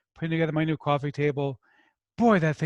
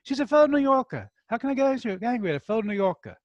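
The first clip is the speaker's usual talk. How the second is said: put-on playful New York accent, pitched higher; mock New York accent, higher; 7 semitones higher than usual. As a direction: ham up a New Yorker defending his own. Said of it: "the only time you'll ever hear me do a New York accent".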